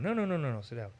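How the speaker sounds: quick friendly no no no, then settle into sit down; hearty no-no-no invitation; welcoming no. Shown as a demonstration. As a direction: genuinely make them welcome. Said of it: "It only matters how you say yes or no".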